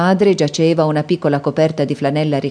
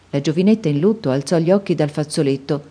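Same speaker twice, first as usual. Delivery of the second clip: plain reading voice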